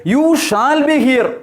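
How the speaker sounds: high, drawn-out emphatic "You shall be here". Shown as a demonstration. Teacher demonstrates the emphatic future for learners, emphatic. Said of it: "Something very strongly pronounced or announced".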